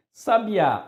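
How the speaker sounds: "Sabiá" with the final á hit high and loud; stress on last syllable. Shown as a demonstration. Teacher showing word stress to students, "Sabiá" stressed on final á. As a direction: punch the end of "Sabiá". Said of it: "oxítona".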